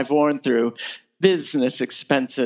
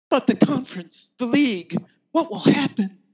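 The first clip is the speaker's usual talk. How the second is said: higher, pleading fluster; faked worry; 7 semitones higher than usual. Countess feigning alarm to her lover, higher and pleading. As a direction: play the anxious maiden, overdone. Countess faking worried protest.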